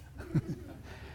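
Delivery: short breathy chuckle; soft laugh. Real, not acted. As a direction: chuckle at your own pun. Laughs at stinketh joke.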